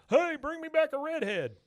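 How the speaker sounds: high, put-on Bill voice calling out; squeaky mock Bill request. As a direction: pitch it way up like Bill begging. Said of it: "And he goes".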